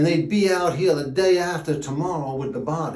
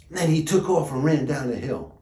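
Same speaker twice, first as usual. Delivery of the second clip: ordinary delivery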